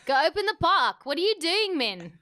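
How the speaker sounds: high, loud South African parent; South African accent. Acted. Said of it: "This is my South African accent".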